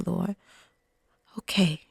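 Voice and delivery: a tiny surrendered okay to God; hushed private surrender; barely audible yes. Pretend. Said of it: "as quiet as you can hear me".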